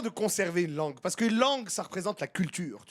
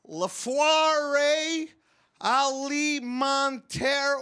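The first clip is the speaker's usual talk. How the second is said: slow, badly pronounced French in an English accent; English accent stumbling through a French sign, slowly; anglophone reading French; 5 semitones higher, much slower than usual. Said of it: "what the fuck is that, man".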